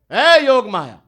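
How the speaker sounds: loud call, high and urgent; child calling his servant in a panic, shouting loudly. Retold or imitated. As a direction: shout for her to come. Child god calls for help.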